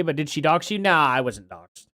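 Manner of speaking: usual speaking voice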